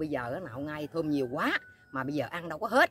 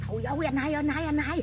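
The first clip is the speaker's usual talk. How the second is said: urgent pleading; 10 semitones higher than usual. Retold or imitated. Pleading to brother in retold scene.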